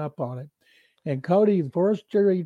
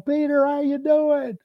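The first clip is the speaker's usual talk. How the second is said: much higher, slow, beaming hello; eager phone greeting; 10 semitones higher, 3 dB louder than usual. Retold or imitated. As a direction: greet him like a big honor. Player greeting reporter on phone.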